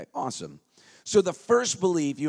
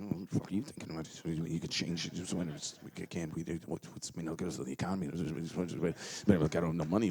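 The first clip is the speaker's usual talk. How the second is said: lower, quiet mocking inner voice; mock your own excuses; inner doubting voice; 10 semitones lower, 12 dB quieter, much faster than usual. The inner voice talking you out of it, quiet mocking. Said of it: "that little inner voice going".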